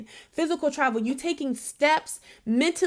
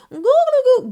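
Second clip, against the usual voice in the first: put-on baby coo; 11 semitones higher, 8 dB louder than usual. Baby cooing in retold playtime.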